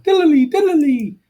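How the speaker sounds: high, slow sung "diddly, diddly"; playful high flashback jingle; flashback sound effect. Retold or imitated. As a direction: hum the wavy dream-sequence cue way up high.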